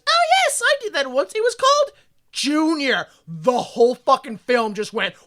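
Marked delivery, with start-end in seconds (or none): 0.1-3.1 higher, bright-eyed cheerful confession; high chirpy mock woman. Character casually admitting she did this once, in a high chirpy voice. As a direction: chirp it like it's no big deal. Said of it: "she goes".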